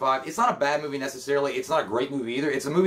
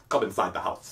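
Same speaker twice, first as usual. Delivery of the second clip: lower, heavy deadpan Arnold voice; Arnold impression; 7 semitones lower than usual. Imitating Arnold inviting the detective in, low and stiff. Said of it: "He's like".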